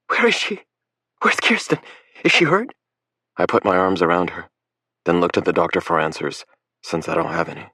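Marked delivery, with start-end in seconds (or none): 0.1-2.7 frantic mother. A narrator's reading. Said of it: "She's crying".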